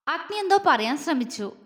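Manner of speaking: plain reading voice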